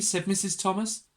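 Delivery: ordinary delivery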